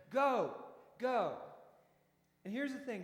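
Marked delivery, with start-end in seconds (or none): 0.1-1.4 loud, punched one-word orders; acted firm command. Jesus pushing disciples back into the storm, acted firm command. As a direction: order them off, louder on each word.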